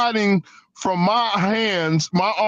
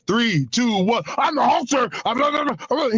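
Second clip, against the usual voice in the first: Hulk Hogan; 5 semitones higher than usual. Retold or imitated. Wrestler resuming his commercial after chatting, hyped-up promo voice. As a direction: count in, then jump into high promo patter. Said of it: "He knew how to turn that thing on and off".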